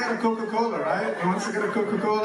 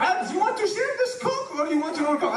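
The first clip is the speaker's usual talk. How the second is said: broken English; 10 semitones higher than usual. Acted. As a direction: offer it in mangled English, "Coke" as "cock". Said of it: "shitty English, right?".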